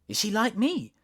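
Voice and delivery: high, bright question; girl eager to find a friend. A narrator's reading. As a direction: lean in hopefully. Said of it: "Alice asked eagerly".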